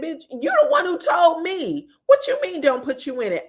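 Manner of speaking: high-pitched, acted-out confrontation; mock indignant retort. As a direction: fire back, imitating an affronted friend. Acted angry retort to Selena.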